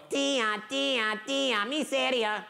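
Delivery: put-on high singsong children's taunt; taunting brats. Brats mock the old woman from her tree, taunting singsong. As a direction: chant it high, like teasing kids. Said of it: "And then they go".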